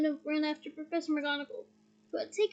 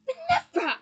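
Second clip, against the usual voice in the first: high, shocked cry; aghast; 9 semitones higher, 6 dB louder, much faster than usual. Acted. Slughorn protests to McGonagall, aghast. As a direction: gasp it out in horror. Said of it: "he said, aghast".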